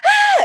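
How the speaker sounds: sudden very high, loud shriek; imitating a lady screaming in fright; startled lady's scream. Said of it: "she goes".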